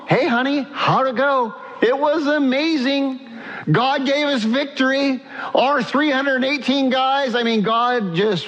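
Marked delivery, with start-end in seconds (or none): 0.1-1.5 higher, lightly voiced wife asking how the war went; a hint of an eager wife welcoming him home; wife greeting husband. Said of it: "Sarai is waiting at home". 1.8-7.3 higher, bubbling with victory news; a husband bursting to tell his wife; excited retelling. Retold or imitated. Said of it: "Abram comes home".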